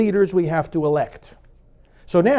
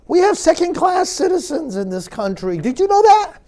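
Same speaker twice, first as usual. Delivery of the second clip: mock-astonished, higher and a bit louder, dripping irony; sarcastic; 10 semitones higher, 3 dB louder than usual. Shown as a demonstration. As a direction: announce it as if it were shocking news. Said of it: "I'm getting sarcastic".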